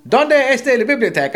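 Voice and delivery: high, loud Spanish TV line; imitated TV voice. TV suddenly proves the friend wrong, high loud imitation. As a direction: blurt it out like a cartoon on telly. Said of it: "Up on the T V comes".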